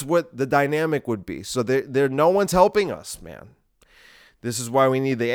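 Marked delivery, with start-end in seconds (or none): none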